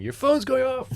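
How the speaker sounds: high, loud retold yell; imitated scream. Wife screaming at sleeping pilot, imitated high yell. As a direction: yell it like a panicked wake-up call. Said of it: "screamed at me and saying".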